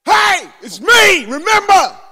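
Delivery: loud, high, drawn-out hail; nagging widow's acted shout; insistent calling out. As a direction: holler to be noticed, stretching it out. Widow pestering the judge, acted.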